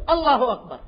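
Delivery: ordinary talk, nothing marked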